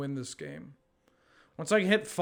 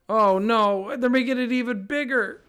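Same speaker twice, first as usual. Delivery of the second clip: loud, higher oh no; alarmed shout; 7 semitones higher, 7 dB louder than usual. Real, not acted. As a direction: cry out in dismay. Mock panic at opponent pump.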